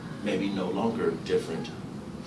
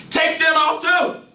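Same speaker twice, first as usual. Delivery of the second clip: high, loud, slow command; a father yelling at his son to strip; furious yell; 7 dB louder, slower than usual. Acted. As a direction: roar it. Gus yells at child.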